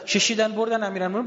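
high, loud mourning wail; lament cry. Acted. Preacher crying out Ali was dragged away, loud wail. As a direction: cry the words out.

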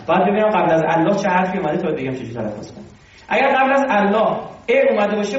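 ordinary talk

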